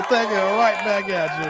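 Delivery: normal talking voice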